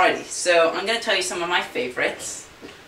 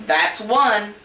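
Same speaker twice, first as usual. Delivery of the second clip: louder, higher stern count; stern warning; 6 semitones higher, 5 dB louder than usual. Acted. Groom counting at the stubborn horse, stern and loud. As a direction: warn the horse sharply.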